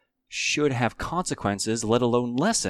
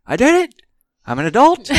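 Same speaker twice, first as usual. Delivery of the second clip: much higher, louder joyful shout; triumphant shout; 14 semitones higher, 14 dB louder than usual. Retold or imitated. Finally opening an investment account, a triumphant shout. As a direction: yell it like you just won. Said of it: "you're like".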